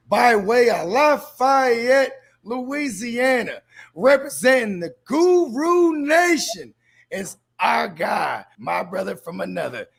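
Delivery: slow stretched hype introduction; ring announcer. Acted. Ring-style introduction of guest.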